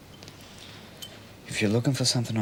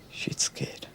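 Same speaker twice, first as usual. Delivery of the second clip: whisper; 5 dB quieter than usual. Acted. Menacing confession to mate.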